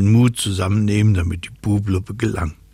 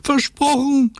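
very high, squeaky promise; high-pitched little-character call; 3 dB louder than usual. Acted. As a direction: cry it out in a tiny high voice. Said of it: "rief Lottchen".

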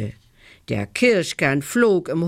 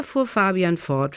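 plain reading voice

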